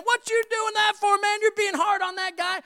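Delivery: high, loud indignant crowd voice; protesting bystander. Retold or imitated. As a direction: call out like an upset onlooker. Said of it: "A crowd got around and was saying".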